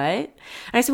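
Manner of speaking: usual speaking voice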